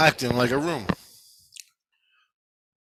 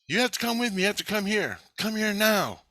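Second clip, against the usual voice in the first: high, pleading imitation of a summons; urgent summons; 9 semitones higher than usual. Retelling his soul being called to come, urgent high voice. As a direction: act out an urgent call, pitched well up.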